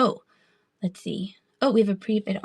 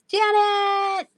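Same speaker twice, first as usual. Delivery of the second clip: high, bright call of her name; excited call; 11 semitones higher than usual. Real, not acted. Host calling a buyer's name during a live sale, excited. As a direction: sing out the winner's name with delight.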